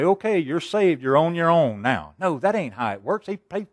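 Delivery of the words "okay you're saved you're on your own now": mock dismissal. Mock quote of abandoning God.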